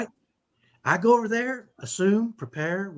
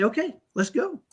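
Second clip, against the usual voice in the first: higher, lightly upbeat acted quote; mock-casual pep, pitched up; retold breezy readiness; 7 semitones higher than usual. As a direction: act out being ready to go. Retold breezy return after the meltdown.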